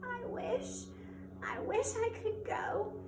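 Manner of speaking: high, tearful plea; a mild hint of sobbing. A narrator's reading. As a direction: Cinderella pleading through tears to the kind woman. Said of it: "for all of her tears and sobbing".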